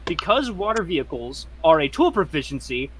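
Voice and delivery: ordinary talk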